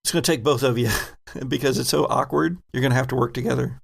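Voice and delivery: usual speaking voice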